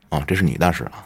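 usual speaking voice

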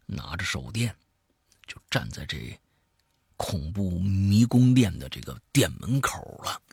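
lively storyteller swinging between hushed and emphatic; spoken horror-story narration; dramatic storytelling. A narrator's reading. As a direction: tell a creepy tale with punched key words. Tells a horror story aloud.

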